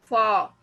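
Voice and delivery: फार with a long open a and no R at the end; no R sound. Shown as a demonstration. Teacher showing Hindi learners फार without R. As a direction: say फार as faa, dropping the R. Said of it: "इसमें आर नहीं बोलते हैं".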